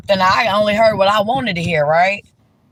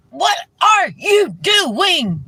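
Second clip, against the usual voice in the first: exasperated shout at screen; 9 semitones higher, much slower than usual. Real, not acted. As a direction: throw the question at the TV in disbelief. Yelling at character on screen.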